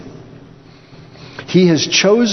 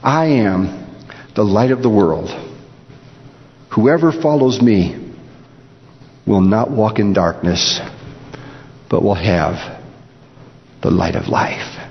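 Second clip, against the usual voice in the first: solemn Jesus quote; 6 semitones lower than usual. Acted. Jesus' words, fading low.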